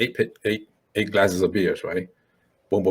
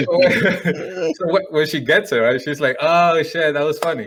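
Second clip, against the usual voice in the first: laughing; 5 semitones higher, slower than usual. Real, not acted. Laughing while telling.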